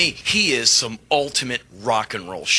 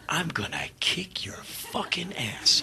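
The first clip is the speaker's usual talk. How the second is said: slow, unvoiced menacing whisper; fierce whisper; 2 semitones higher, 8 dB quieter, slower than usual. Singer vowing to beat [name] before the gig, whispered. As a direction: swear revenge under your breath. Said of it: "I'm like".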